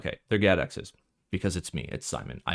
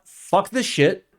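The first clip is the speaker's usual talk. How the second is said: defiant outburst; 9 semitones higher, 6 dB louder, slower than usual. Retold or imitated. Imagined collective defiance.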